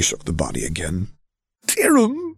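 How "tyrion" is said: high, loud anguished call; desperate cry. Acted. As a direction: cry the name out. Prince calls out to body.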